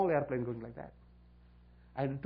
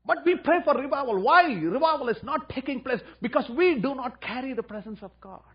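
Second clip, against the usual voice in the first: preaching at full force; 9 semitones higher, 9 dB louder than usual. Real, not acted. Preacher rebuking the church, at full force. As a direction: cry it out over the congregation.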